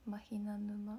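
soft breathy aside, no voice; unvoiced playful whisper. Real, not acted. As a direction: whisper it like a secret. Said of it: "whispered aside".

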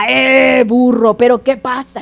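loud call to the donkey; a lion hollering a mocking question. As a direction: shout across at the donkey, bold lion. Lion calls out to stuck donkey.

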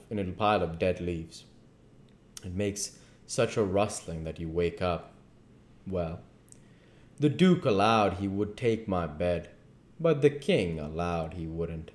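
plain reading voice

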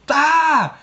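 high, exasperated "Ah!"; mock horrified cry. Reacting to the woman taking over YouTube, mock horrified cry. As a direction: cry out in comic alarm. Mock horror at her takeover.

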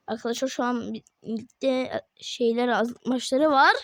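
ordinary talk

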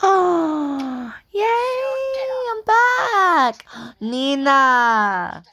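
high drawn-out squeal of joy; gasp, then cheer it out high; delighted reunion. Pretend. Doll greets friend on coming back, delighted squeal. Toys reunite in pretend play.

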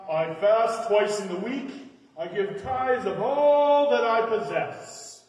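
loud, slow boast in a put-on accent; a Pharisee boasting about his piety in a loud, slow put-on accent. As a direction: boast slowly and loudly in the same made-up accent. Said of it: "I don't know where I got that accent".

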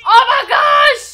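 loud, high, drawn-out shout; amazed scream. Real, not acted. Gamer surprised by the next floor, amazed scream. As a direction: scream it slowly in astonishment. Surprise music in dark room.

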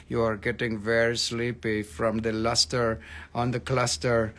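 low, softened, lulling delivery; mock hypnotist. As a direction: lull them to sleep like a stage hypnotist. Plays hypnotist putting viewers to sleep.

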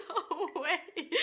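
laughing in disbelief. Real, not acted. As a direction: laugh through the words. Ice cream spilled on her jeans.

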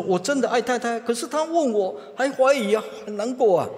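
high-pitched tearful lament; mock crying. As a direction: act out a husband wailing over his doubting wife. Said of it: "所以他哭就说". (带哭腔地哭诉)

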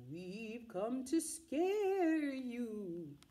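very slow, drawn-out haunting voice; slow eerie voice of the haunted clothes; spooky creature voice. Acted. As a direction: stretch every word like a ghost. Spooky clothes answer the lady.

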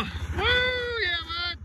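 high excited cheer. Real, not acted. Cheering kid sledding past.

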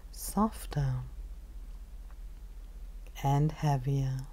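calm, unhurried meditation guide with long pauses; measured body-scan narration, phrase by phrase; guided meditation. Acted.

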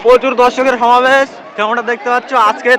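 ordinary delivery

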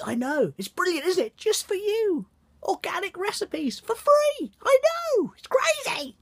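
high, squeaky hyped-up voice; mock excitement. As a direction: act giddy and overexcited. Mock excited free recipe boast.

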